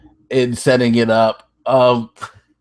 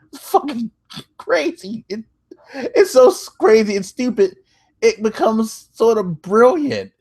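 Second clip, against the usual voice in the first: crying with laughter; 10 semitones higher than usual. Real, not acted. Laughing disbelief at the bit.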